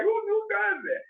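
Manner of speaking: very high, soft incredulous question; retold disbelief. Coworker reacting to a man quitting by note, incredulous. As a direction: ask it in a high, baffled voice.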